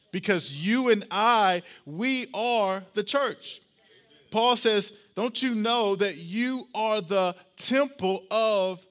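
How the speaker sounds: loud, slow sermon peak, rising on we are; full-force preaching. Acted. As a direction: preach it big and slow to the room.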